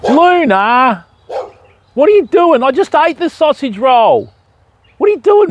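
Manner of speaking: high-pitched sing-song talk to a dog. Real, not acted. Fisherman calling a curious dog over, high-pitched doggy talk. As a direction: coo at the puppy in a high voice.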